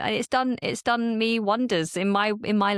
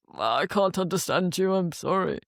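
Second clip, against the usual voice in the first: imitated politician. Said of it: "the guy he was talking to said".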